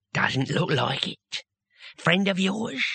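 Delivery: put-on slow, lightly squelchy old voice; sly murmur, then a lift. Captor voice continues.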